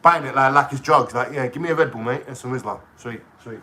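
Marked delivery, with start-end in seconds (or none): 1.3-3.6 furtive drug deal. Retold or imitated. Buying Red Bull like drugs.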